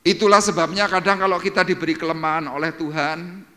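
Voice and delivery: high, loud pulpit exhortation; fervent sermon voice; preaching at full force. Acted. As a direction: drive the point home to the congregation. Preacher exhorting congregation loudly.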